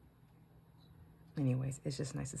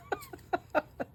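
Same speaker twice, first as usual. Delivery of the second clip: high laugh. Real, not acted. Laughing at the story.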